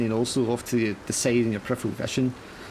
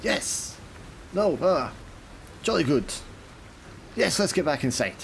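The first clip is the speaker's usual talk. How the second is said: flustered captain; 7 semitones higher than usual. Acted. Captain pulls himself together before the sailor. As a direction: bluster back to composure as the captain.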